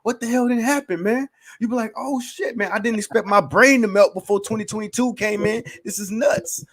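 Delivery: high, excited amazement; blown-away listener. Pretend. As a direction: act out a mind-blown fan. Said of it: "you put on Kobayashi and you be like".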